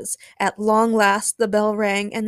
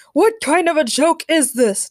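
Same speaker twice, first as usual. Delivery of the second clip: loud, raised, indignant demand; 8 semitones higher, 6 dB louder than usual. A narrator's reading. The Rock confronts his mysterious lookalike, demanding. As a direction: demand an answer, outraged. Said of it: "demanded The Rock".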